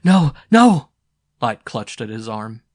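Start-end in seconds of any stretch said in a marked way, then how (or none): 0.0-0.9 high, loud frightened cry; panicked pleading cry; desperate plea. Acted. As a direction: beg him not to go. Light pleads clutching his arm.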